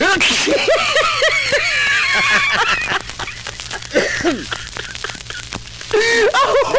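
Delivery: a long, high burst of laughter; long shrieking laugh. Real, not acted. Co-host laughs hard at the host's joke, long shrieking laugh.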